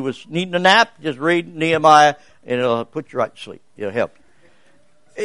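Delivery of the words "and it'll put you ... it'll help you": mock drowsy fade. Pastor joking to the church that Nehemiah puts you to sleep, mock drowsy fade. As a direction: let it trail away like you're falling asleep. Joke about the book causing sleep.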